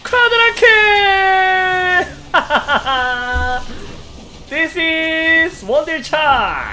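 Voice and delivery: high, loud screaming hype; esports caster. Real, not acted. Celebrating quadra kill.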